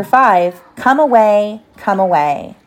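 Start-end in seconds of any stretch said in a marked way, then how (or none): none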